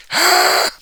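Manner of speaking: loud voiceless rasping "Ah!"; short burst of freaking-out noise; freak-out scream. Acted. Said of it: "having a conniption fit and freaking out, going".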